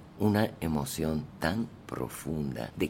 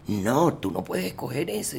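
writer refusing; 8 semitones higher, 3 dB louder than usual. Retold or imitated. García Márquez refusing the story.

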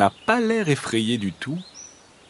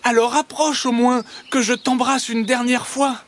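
high, plaintive hedgehog voice; squeaky, wistful appeal; sighing hedgehog; 13 semitones higher than usual. A narrator's reading. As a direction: make a mournful farewell plea, pitched high. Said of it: "soupire le hérisson".

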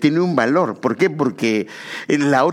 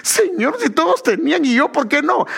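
much higher, whiny plea to God; Adam complaining; 11 semitones higher than usual. Retold or imitated. Preacher voicing Adam pleading with God, high and whiny. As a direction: play Adam begging, pitched way up.

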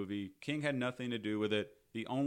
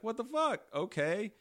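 high, louder retold disbelief; mock bafflement; 12 semitones higher, 4 dB louder than usual. Dad reacting to being handed his kid, mock baffled. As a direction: imitate a baffled, reluctant dad.

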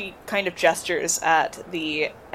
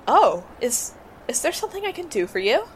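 receptionist voice; 6 semitones higher, faster than usual. Acted.

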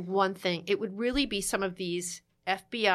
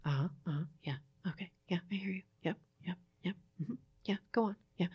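softer, low-key listening murmurs; soft, patient uh-huhs of a listening spouse; mirroring murmurs; 4 semitones lower, 9 dB quieter, faster than usual. Shown as a demonstration. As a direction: nod along so a ranting partner feels heard. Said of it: "you just go".

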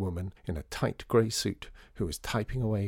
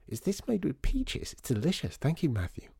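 put-on child voice; 7 semitones higher than usual. Child Lydia sips drink.

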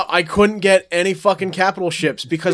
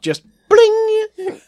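bling sound effect; 4 dB louder than usual. Shown as a demonstration. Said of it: "Every time you hear me say".

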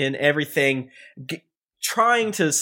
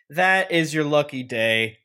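ordinary talk

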